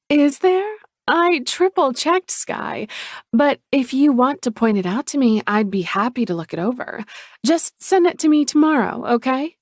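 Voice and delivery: a falsely innocent sister brushing it off; Chloe's voice. Acted.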